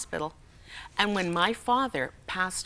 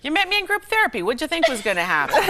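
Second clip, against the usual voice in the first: teasing husband; 6 semitones higher, 6 dB louder, faster than usual. Retold or imitated. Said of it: "he'll say".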